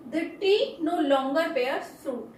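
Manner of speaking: very slow, every word spaced out; slow dictation. Shown as a demonstration. Teacher reading an example sentence very slowly to students. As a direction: read it out slowly so students can write it down.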